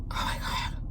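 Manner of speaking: whispered under the breath, choked by the memory; overcome whisper. Real, not acted. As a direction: barely get it out, overwhelmed. Overcome recalling her daughter's heart stopping.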